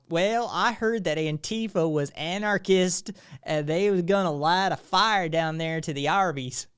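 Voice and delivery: loud, drawn-out Southern accent. Shown as a demonstration. Streamer badly faking a chat friend's Southern accent. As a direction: lay on a clumsy, hammed-up Southern drawl. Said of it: "your part of the world Southern accent".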